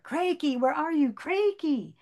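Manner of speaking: high calling voice over the pond. A narrator's reading. Miriam wading in, calling for her lost Crakey. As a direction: call out across the water, searching.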